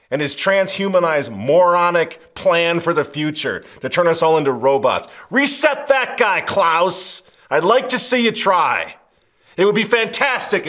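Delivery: loud, high-pitched taunting challenge; scornful rant. Real, not acted. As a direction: build to a loud, derisive dare. Mocking challenge to Klaus Schwab.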